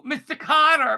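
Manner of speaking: loud, high catchphrase shout. Retold or imitated. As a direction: call it like the sitcom catchphrase. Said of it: "Who used to say that?".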